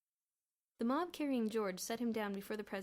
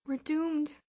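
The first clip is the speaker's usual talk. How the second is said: higher, louder despairing moan; dismal moan; 6 semitones higher, 9 dB louder than usual. A narrator's reading. Boots despairs over the stock, moaning. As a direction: moan it like all is lost. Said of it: "moaned Boots dismally".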